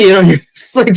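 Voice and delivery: usual speaking voice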